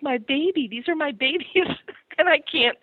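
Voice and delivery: ordinary talk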